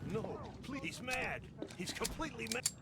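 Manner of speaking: panicked pleading. Acted. Panicked NPC in game.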